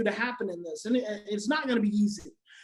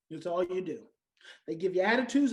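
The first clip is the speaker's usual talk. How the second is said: ordinary talk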